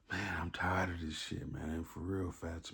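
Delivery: soft, worn-out mock complaint; retold weariness. Acting out his cellmate coming back mad, softly weary. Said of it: "the way that he came in the room was like".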